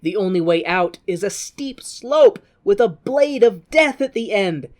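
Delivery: normal talking voice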